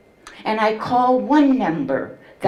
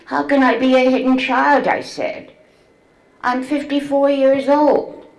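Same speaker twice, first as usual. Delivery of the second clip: incredulous; 5 semitones higher, 4 dB louder than usual. Retold or imitated. Incredulous reply to doctor.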